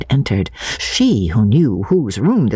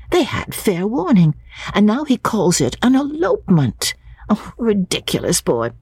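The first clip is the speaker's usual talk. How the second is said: put-on higher young woman, fondly exasperated; Lucy's voice; 6 semitones higher than usual. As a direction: play Lucy scolding her absent brother affectionately. Lucy voiced by narrator.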